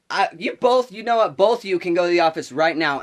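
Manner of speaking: loud, high retold teacher's command; angry ordering. Teacher sending both girls to the office, angry command. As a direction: send them out, imitating a fuming teacher.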